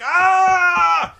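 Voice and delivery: loud high held "aah" of agony; long hammed-up yell of pain; mock pain scream. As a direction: imitate a wrestler howling in an armbar. Imitating man in knee bar.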